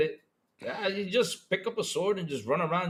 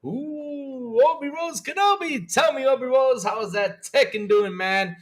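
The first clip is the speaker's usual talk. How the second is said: higher and louder, big excited greetings; hyped shout-outs; 8 semitones higher, 8 dB louder, slower than usual. Real, not acted. Streamer greeting his chat by name, loud and hyped. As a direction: pump up every name like a hype man.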